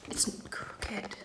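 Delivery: quick whispered aside. Real, not acted. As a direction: whisper to herself while fixing the camera. Aside fixing the camera.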